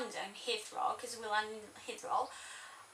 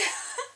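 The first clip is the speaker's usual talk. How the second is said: squeaky high laugh; laughing at the memory; 13 semitones higher than usual. Real, not acted. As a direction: burst into a giggle. Laughs after acted greeting.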